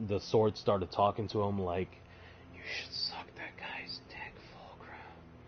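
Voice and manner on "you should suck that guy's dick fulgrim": breathy sinister whisper; tempting whisper. Retold or imitated. Sword whispering to Fulgrim, sly tempting whisper. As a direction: hiss the temptation into his ear.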